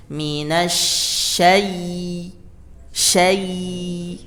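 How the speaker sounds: syai- held long with stressed i; over enunciating. Shown as a demonstration. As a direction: press the i in syai- hard. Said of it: "tekan bunyi i-nya ya".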